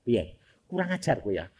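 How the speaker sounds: mock grumble as an offended mother; softly imitating an annoyed mother; scolding mother. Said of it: "ibu-ibu ... bilang".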